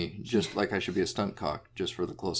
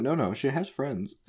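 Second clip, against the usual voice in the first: higher, slow, insistent reassurance; earnest explaining; 7 semitones higher than usual. Retold or imitated. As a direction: hurry to correct the joke, stretching it out. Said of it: "I have to explain".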